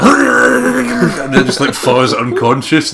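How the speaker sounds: laughing through the words, slowed. Real, not acted. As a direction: crack up as you say it. Laughing mid-story about a ghost.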